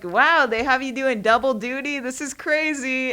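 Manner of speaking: loud, mock astonished; mock amazement. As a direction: act amazed at his job. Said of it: "I was like".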